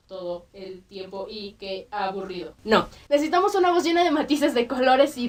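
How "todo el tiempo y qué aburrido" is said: low, soft, flat robot drone; droning robot voice, lower and softer, slowing at the end; robot monotone. Shown as a demonstration. Teacher mocking a boring voice, low soft robot monotone. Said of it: "no suene tan plana como un robot".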